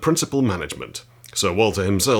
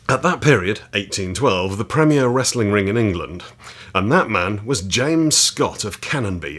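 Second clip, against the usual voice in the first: a firm, louder read-aloud voice; 4 dB louder than usual. Acted. Reading chapter six of the wrestling book to viewers, with relish. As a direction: read the old text out with relish.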